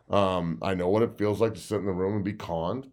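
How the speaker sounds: ordinary talk, nothing marked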